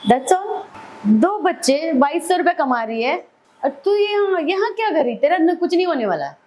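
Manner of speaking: high, insistent scolding friend; a friend urging her, high and insistent; pushy friend. Retold or imitated. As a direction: push her hard to leave him. Friend scolding her over phone.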